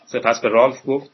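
plain reading voice

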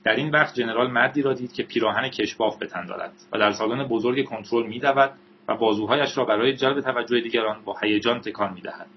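usual speaking voice

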